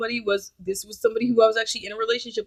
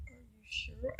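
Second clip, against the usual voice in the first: imitating him, barely audible; very soft, put-on doubtful question; hushed mock question; 21 dB quieter, much slower than usual. Date doubting she is awake, hushed mock question.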